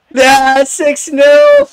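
high, elated shout; triumphant yell. Real, not acted. Gamer celebrating a sixth goal, triumphant yell. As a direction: yell the score high and long.

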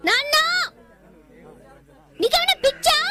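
high, loud outburst at her father; angry outburst. Acted. Daughter yelling at her father, angry and loud. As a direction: shout it at him in disbelief.

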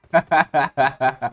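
loud, rapid chuckling burst; loud laugh. Real, not acted. Laughing about the teammate.

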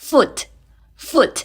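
"foot" with short vowel, final t; model pronunciation. Shown as a demonstration. Teacher models "foot" for students, model pronunciation. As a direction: pronounce "foot" short, ending on a crisp t. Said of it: "อ่านออกเสียงไม่เหมือนกันนะ".

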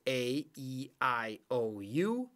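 A, E, I, O, U as long letter names, U lifted at the end; long vowel sounds. Shown as a demonstration. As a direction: recite A, E, I, O, U like the alphabet. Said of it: "that's the long, uh, vowel sound".

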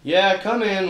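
usual speaking voice

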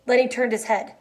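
ordinary delivery, nothing marked

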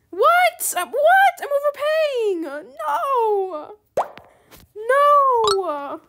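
very high, loud wail of protest; mad, disbelieving. Real, not acted. Player overpaying for a Neon Deer, outraged wail. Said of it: "I'm so mad".

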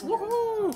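high, drawn-out woohoo; celebratory whoop. Real, not acted.

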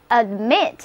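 "admit" with the stress on MIT; stress on second syllable. Shown as a demonstration. Teacher models "admit" for learners to repeat, stressing MIT. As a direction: model "admit": weak first vowel, then stressed MIT. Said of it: "重音放在第二个音节上".

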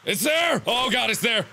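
high cry of alarm. Real, not acted. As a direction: call out the sudden sighting in panic. Sees monster in game.

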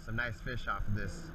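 usual speaking voice

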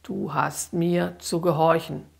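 ordinary delivery, nothing marked

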